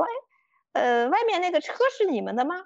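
a hint of an uncle asking through the door; middle-aged man's voice. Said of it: "是一个大叔的声音".

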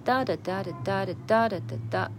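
"哒哒哒哒哒哒哒哒哒哒" with beats alternately stressed and light; beat-counting "哒哒哒", accented and unaccented syllables; rhythm demo. Shown as a demonstration. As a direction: tap out the stress pattern on "哒哒哒哒哒哒哒哒哒哒". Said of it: "把节奏先把握起来".

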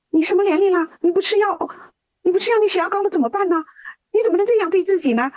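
high, fast, tearful pleading in imitation; imitating a crying boy's worried pleading; retold crying plea. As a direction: mimic a teenager begging through tears. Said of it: "哭着说".

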